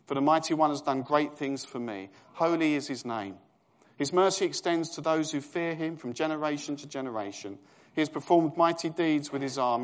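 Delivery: fervent scripture read. Acted. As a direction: declaim it to the congregation. Reads Mary's song.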